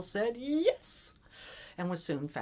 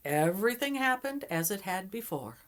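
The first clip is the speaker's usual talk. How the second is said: ordinary delivery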